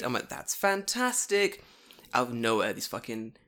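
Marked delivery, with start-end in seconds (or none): 0.3-1.6 high, stretched-out mock delight; overdone enthusiasm. Teacher praising student's answer, slow overdone delight. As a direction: draw it out, high and encouraging. Retold English practice small talk.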